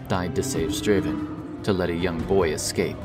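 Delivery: plain reading voice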